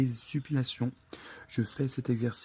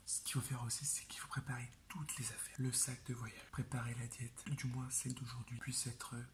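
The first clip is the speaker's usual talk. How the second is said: soft unvoiced whisper; library whisper; 11 dB quieter than usual. Real, not acted. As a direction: keep it hushed, house asleep. Still whispering, others asleep. (chuchotement tout bas)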